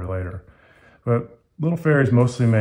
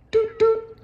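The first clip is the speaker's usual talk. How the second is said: very high double toot; squeaky horn noise. Acted. Boat horn imitated.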